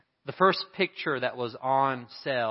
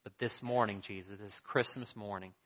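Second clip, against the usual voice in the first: much softer, intimate invitation; gentle altar call; 8 dB quieter than usual. Acted. Pastor invites listeners to receive Jesus, soft and intimate. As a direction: coax them quietly to raise a hand.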